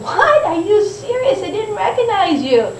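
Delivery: high, sharp disbelief on "What?"; surprised. Retold or imitated. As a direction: gasp it out in shock. Shocked at meeting Wanda.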